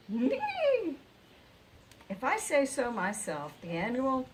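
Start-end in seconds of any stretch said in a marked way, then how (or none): 0.1-1.0 very high, loud whinny; horse neigh. Acted. Neighbors' animal cheers.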